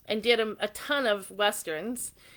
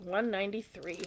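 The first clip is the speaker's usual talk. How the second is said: "One ninety-three" repeated softer as an aside; 6 dB quieter than usual. Shown as a demonstration. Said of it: "are number one ninety-three".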